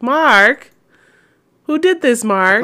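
high, loud, hammed-up demand; Wiseau-style Zenitsu. Friend imitating Wiseau-voiced Zenitsu, high hammy demand. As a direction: wail the accusation in a mock Wiseau voice. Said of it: "he's just like".

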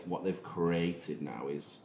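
ordinary talk

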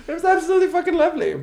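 high, fast, bright sarcastic gush. Acted. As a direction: gush it with forced cheer. Sarcastic after grandparents died.

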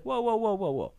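mildly acted game warden cutting in; quick halting whoas, starting high and dropping; game warden halting someone. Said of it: "He's like".

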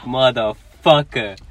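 exasperated curse. Retold or imitated. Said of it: "I'm like".

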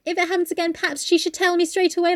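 prissy quoted reproach; mimicked prim housekeeper. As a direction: lightly mimic her snide suggestion. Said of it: "it's the way she says it".

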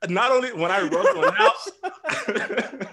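can barely get it out for laughing. Real, not acted. Telling the punchline to friends, slowed by laughter.

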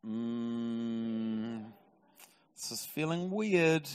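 long hesitant hum, then slow discomfort; mildly mock-awkward, dragging it out; uneasy. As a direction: play someone reluctantly going along with it. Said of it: "And he's like".

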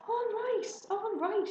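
high, exasperated surrender; giving in. Acted. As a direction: give in with a high-pitched protest. Father gives in to pleading.

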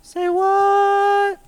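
high jingle shout. Acted. Cohost answers the segment intro with a squeaky jingle. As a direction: squeak out a cartoonish high Say what.

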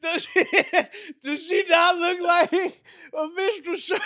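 slow, high words through loud laughter; laughing. Real, not acted. Roasting a woman's looks to his friend, laughing hard. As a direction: crack up while forcing the question out.

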